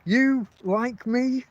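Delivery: drawn-out slow question, high; slow disbelieving repeat. Retold or imitated. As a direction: spell it out slowly, incredulous.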